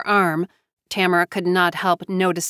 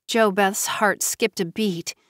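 plain reading voice

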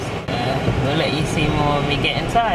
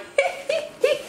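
laugh; 3 dB quieter than usual. Real, not acted. Laughs after Irish attempt.

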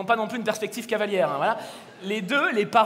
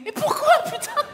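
exasperated; 11 semitones higher than usual. Acted. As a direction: throw up your hands, pitch way up. Exasperated reaction to baby names.

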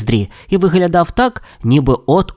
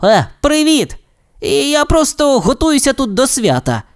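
higher, mildly acted character reply; slightly flustered Steve; 12 semitones higher than usual. A narrator's reading. Steve greeting Alex and excusing himself, mildly flustered. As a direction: explain it away in a higher voice.

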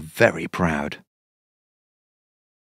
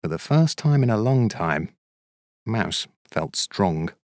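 ordinary delivery

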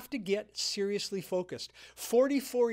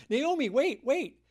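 calling out from behind, higher and louder; calling after her; 6 semitones higher, 5 dB louder than usual. Retold or imitated. Daughters-in-law calling after Naomi to wait, calling out. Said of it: "they're saying".

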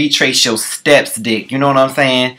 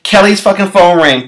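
normal talking voice

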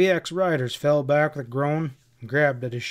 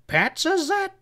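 very high-pitched, incredulous question; stunned squeak rising at the end; gasped in shock. A narrator's reading. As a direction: gasp it out in disbelief. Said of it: "gasped Sam".

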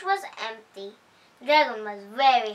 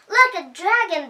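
mocking animals; 6 semitones higher, 5 dB louder than usual. A narrator's reading. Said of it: "they cried".

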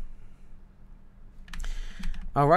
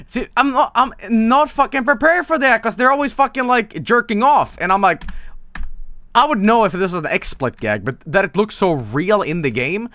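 high, loud, exasperated complaint; real flustered outburst in a raised pitch; agitated rant; 9 semitones higher, 5 dB louder than usual. Real, not acted. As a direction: protest loudly, still rattled. Rattled rant about game scares.

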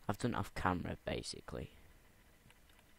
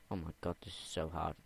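ordinary talk, nothing marked

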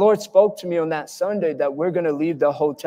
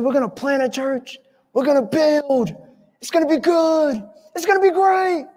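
high, rising rally call from a preacher; excited rallying; 11 semitones higher than usual. Retold or imitated. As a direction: hype up the crowd, pitch climbing each phrase. Retold rallying speech from dream.

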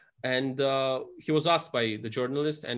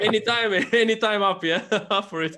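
much higher and louder, laughing through a jokey acceptance; laughing eagerly; 8 semitones higher, 7 dB louder than usual. Real, not acted. Cheerfully taking up a friend's challenge, laughing loudly. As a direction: toss it back with a delighted laugh.

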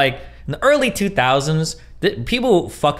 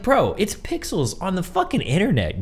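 ordinary talk, nothing marked

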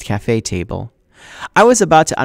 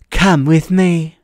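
high, very slow, syrupy menace; menacing teacher; 7 semitones higher, 4 dB louder, much slower than usual. Acted. Mrs Dodds to Percy, slow sugary threat. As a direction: drag it out with fake sweetness. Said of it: "Missus Dodds said".

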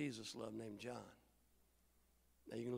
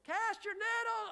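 high shouted call; imagined call; 5 dB louder than usual. Retold or imitated. Preacher replaying the shore call in his head, high shout. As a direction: fling it high across the water. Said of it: "in my head, it went like this".

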